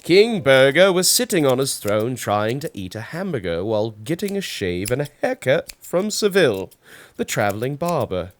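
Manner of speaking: plain reading voice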